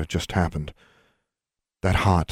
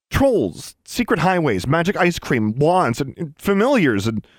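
high-pitched, exasperated disbelief; incredulous listing; 10 semitones higher, 4 dB louder, slower than usual. A narrator's reading. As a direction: reel off each item incredulously. Boy listing things in disbelief.

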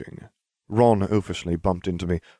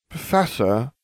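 higher, careful address; slow, hesitant; 6 semitones higher, slower than usual. A narrator's reading. Hermione cautiously addresses the professor, slow and hesitant. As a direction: say it slowly, feeling your way. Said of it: "Hermione said slowly".

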